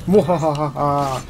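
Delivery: slow, low villain cackle; mock evil laugh. Player gloating after slashing an enemy, mock evil laugh.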